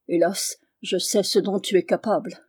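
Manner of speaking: ordinary delivery, nothing marked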